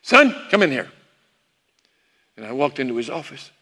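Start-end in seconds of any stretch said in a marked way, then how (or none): 0.0-0.9 imitated father summoning his son; a touch of Dad's commanding call; dad's way of talking. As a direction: call him in, higher voice. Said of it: "that's the way he talked".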